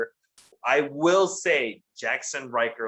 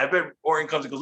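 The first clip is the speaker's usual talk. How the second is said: ordinary talk, nothing marked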